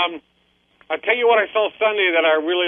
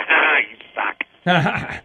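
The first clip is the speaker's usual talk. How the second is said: taunting; much slower than usual. Retold or imitated. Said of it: "telling my daughter-in-law ... that".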